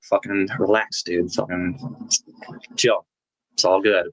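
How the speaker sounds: low, laid-back imitation with lazy pauses; chill Benicio del Toro. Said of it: "he's totally chill".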